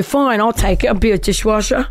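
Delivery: imitated British accent, keen to accept. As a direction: take the job in a put-on British accent. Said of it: "I'm talking a British accent".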